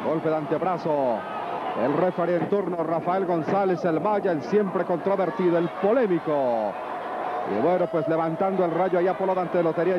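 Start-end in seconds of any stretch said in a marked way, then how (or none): none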